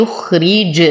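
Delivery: يخرج with a bouncing release of ج; qalqalah on jīm. Shown as a demonstration. Teacher showing qalqalah, bounced ج ending يخرج. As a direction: let the final ج of يخرج echo slightly. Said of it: "وقلقل الجيم".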